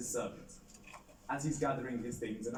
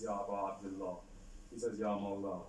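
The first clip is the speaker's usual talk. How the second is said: humble plea; 5 semitones lower, 6 dB quieter than usual. A narrator's reading. Old soldier addressing Imam.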